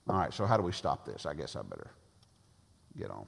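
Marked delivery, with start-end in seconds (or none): none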